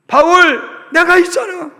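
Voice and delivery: loud, high reassurance; encouraging call. Acted. Barnabas loudly encouraging a struggling Paul. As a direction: lift him up with loud cheer.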